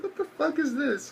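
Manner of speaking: very high, squeaky mock disbelief; incredulous reaction. Viewer reacting to the father's dark joke, incredulous. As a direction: pitch it way up, appalled.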